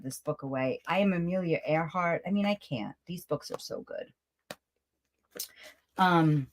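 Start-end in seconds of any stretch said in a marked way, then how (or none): 3.1-4.1 whispered aside, voiceless; a fond whisper about the books. Real, not acted. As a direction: breathe it like a secret. Aside about her beloved books.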